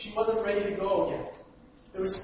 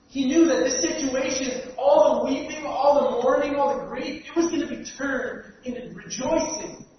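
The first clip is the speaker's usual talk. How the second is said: fervent preaching; 3 semitones higher, 6 dB louder than usual. Acted. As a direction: preach it, building the fire. Preacher builds to full force.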